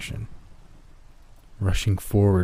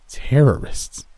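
sharp retort; 5 semitones higher, 4 dB louder, slower than usual. Acted. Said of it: "he shot back".

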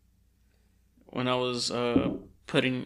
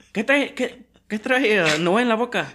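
much higher and louder mock mom, laughing; mom's question; 9 semitones higher, 7 dB louder than usual. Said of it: "she'll be like".

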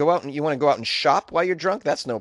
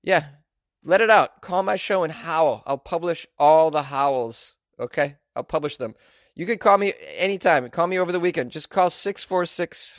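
hyped radio host; 3 semitones higher than usual. Acted. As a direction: pump up the listeners like a late-night DJ. Radio host inviting howls.

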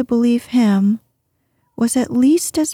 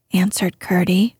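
ordinary delivery, nothing marked

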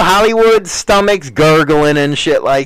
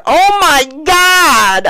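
shocked shriek; 11 semitones higher, slower than usual. Retold or imitated.

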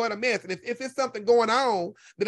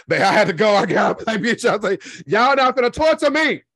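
loud exasperated; 9 dB louder than usual. Retold or imitated. Loud recounting of leaving.

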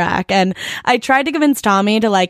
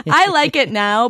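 normal talking voice

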